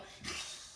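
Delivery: soft voiceless hiss; cat hiss. Shown as a demonstration. Teacher showing kids a scared cat, soft hiss. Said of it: "They hiss and they go".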